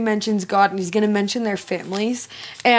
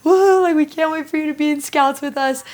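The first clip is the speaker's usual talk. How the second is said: put-on high, gushing scout leader greeting a new boy; bubbly, over the top, like a thrilled den mother; higher-pitched cheer for the boy's first day; excited welcome; 4 semitones higher than usual. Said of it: "she's like".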